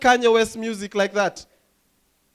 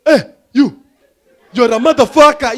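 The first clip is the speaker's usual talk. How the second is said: loud, high, aggressive African accent; 4 semitones higher, 10 dB louder than usual. Shown as a demonstration. Comic showing African accent on an insult, aggressive African accent. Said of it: "You can't say that with an African accent. It's very aggressive".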